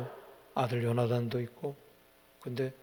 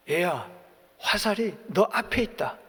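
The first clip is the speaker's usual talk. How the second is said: raised call across the field; 6 semitones higher, 5 dB louder than usual. Retold or imitated. Jonathan calls to the boy, raised call. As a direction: call out to the boy far ahead. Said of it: "내가 뒤에서 외치기를".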